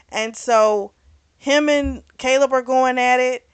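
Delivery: ordinary talk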